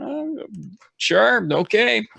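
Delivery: high, slow stunned okay; stunned disbelief. Retold or imitated. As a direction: slowly give in, dumbfounded. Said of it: "And I'm looking at rules going".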